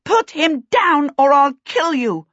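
high, loud threatening shout. A narrator's reading. Sophie chasing the kidnappers to save a child, shouting. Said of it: "Sophie behind Waif shouting".

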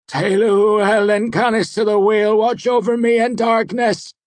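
loud, high, pleading prayer; desperate litany. A narrator's reading. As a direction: cry out the prayer in fear. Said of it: "he took up his litany again".

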